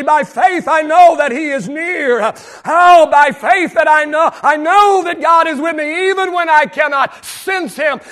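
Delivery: fervent sermon climax; preaching at full force. Acted. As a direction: build the by faith cry to the congregation. Building preaching refrain.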